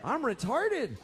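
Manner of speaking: put-on high-pitched, incredulous kid's protest; acted dismay. Kid arriving at the wrong camp, dismayed disbelief. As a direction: play stunned disbelief, pitched well up. Child's shocked realisation at camp.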